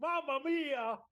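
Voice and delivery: high, hammed-up Italian accent; mock Italian accent. [name] improvises an Italian criminal, hammed-up Italian accent. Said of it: "the accent is something else".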